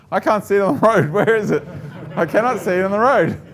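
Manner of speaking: high, loud imitation of frightened protest; mock panic. Frightened colleague searching the road for a spider, mock panic. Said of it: "he's very frightened of spiders".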